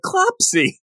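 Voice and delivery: very high, cooing "Clopsy"; cooing pet name. Retold or imitated. Ulick greets his pet monster, cooing very high. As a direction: coo it like calling a beloved pet. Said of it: "he's like".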